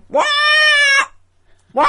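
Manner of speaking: mock raven croak "Wah"; raven caw. Preacher mimicking a raven's morning caw. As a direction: squawk it like a raven. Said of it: "So a raven's like".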